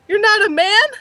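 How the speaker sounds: high, loud mock-hurt disbelief; shocked betrayal. Clark finding out his friend lied, shocked. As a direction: squeal it as if deeply betrayed. Clark learns Oswald is a woman.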